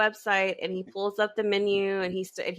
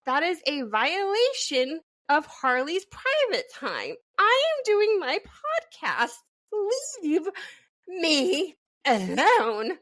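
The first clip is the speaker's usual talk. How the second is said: mock indignation; 8 semitones higher than usual. Podcaster shooing the brood from private podcast time, mock indignant. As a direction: put on a big, pouty objection with spaced-out words.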